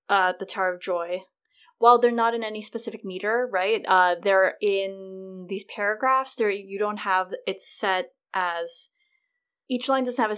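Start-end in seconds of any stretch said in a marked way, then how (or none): none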